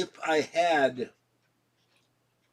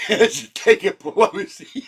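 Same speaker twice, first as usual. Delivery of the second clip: slow words through real laughter; laughing; 7 semitones higher, 9 dB louder, slower than usual. Real, not acted. Laughing at his own Pelosi joke, words through laughter.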